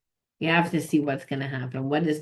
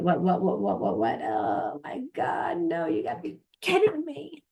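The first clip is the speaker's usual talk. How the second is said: can't believe what the cards show; shocked disbelief; 5 semitones higher than usual. Real, not acted. Shocked reaction to drawn card.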